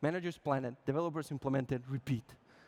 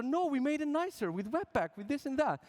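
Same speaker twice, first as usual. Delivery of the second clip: high-pitched, eager objection; insistent protest; 7 semitones higher than usual. Acted. Developers arguing back at users, insistent. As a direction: push back like a proud, defensive developer.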